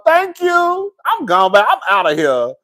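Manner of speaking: usual speaking voice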